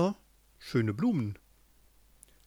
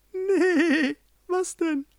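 very high-pitched bleating sheep voice; much slower than usual. A narrator's reading. Sheep answers the ram, very high bleating voice. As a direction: squeak it out like a silly sheep. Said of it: "Die Schafe".

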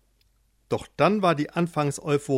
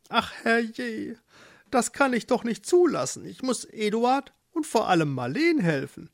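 high, dismayed mole voice; unhappy mole; 8 semitones higher than usual. Acted. Mole resolves to help his friends, high and distressed. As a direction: fret as a little animal. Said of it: "auch ganz unglücklich".

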